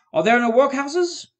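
high, loud, slow retort; scornful. A narrator's reading. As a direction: hurl the question with scorn. Spirit throws his words back.

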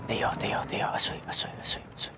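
hushed whisper while spotting an enemy. Real, not acted. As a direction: breathe the words, barely voiced.

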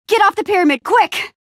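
high, urgent shout; urgent warning. Acted. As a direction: call out a panicked order to hurry.

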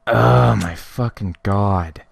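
loud, slow, drawn-out exasperation; irritated groan. Real, not acted. Gamer sent all the way back, loudly exasperated. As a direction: drag the swear out loudly in irritation. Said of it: "It's getting irritating".

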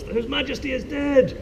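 high mock alarm call; yell. Guard announcing the king's death. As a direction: cry out the news high. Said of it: "Then somebody yells".

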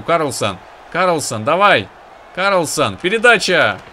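loud, high urgent yelling at player; excited shouting. Real, not acted. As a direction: yell the pass call urgently. Urging player on counterattack.

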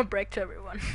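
mocking echo through laughter; amused echo of the line; laughing repeat. Real, not acted. Repeating in-game text while laughing.

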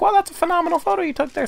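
high, fast chirpy praise; mock professor. Imitating the professor's praise in a high quick voice. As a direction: squeak out the compliment quickly. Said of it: "He didn't be like".

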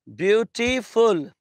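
"Beautiful" slowly broken into beau, ti, ful; syllable by syllable. Shown as a demonstration. Teacher showing students a polysyllabic word, "Beautiful" slowly split syllable by syllable. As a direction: say "Beautiful" slowly, each of its three syllables apart. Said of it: "I can break it into".

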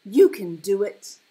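full-voiced encouraging yell; shouting. A narrator's reading. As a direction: shout it to spur on a friend. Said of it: "Lars shouted".

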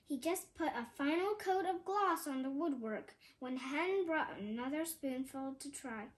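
usual speaking voice

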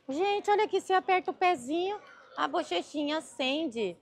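high, delighted squeal over the cute plush; excited squeal. Real, not acted. Showing viewers a plush whose cheeks light up, high excited squeal.

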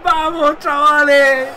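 loud, high triumphant cheer; rally the lads at the top of your voice; rallying shout. Real, not acted. Player rallies his lads after scoring, loud shout.

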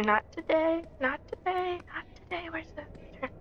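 high, fading frightened pleading; real panicked high whisper; rising scared squeak. Real, not acted. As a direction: squeak it out, backing away. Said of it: "I saw something and I didn't like it".

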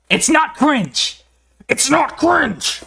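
drawn-out, high, mock-offended whine; mock protest. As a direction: stretch it out like a pretend-wounded kid. Chat calls the video cringe.

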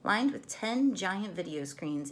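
usual speaking voice

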